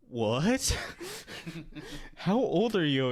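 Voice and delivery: shocked disbelief. Retold or imitated. Imitating Andrew stunned at the pizza place, loud and baffled. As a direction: sound floored by the news. Andrew's confused reaction retold.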